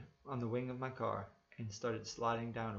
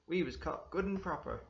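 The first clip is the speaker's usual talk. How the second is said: higher, cheeky cockney voice; cockney passenger; 7 semitones higher, 4 dB louder than usual. A narrator's reading. Passenger to the driver, cheeky and higher. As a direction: relish the bad news.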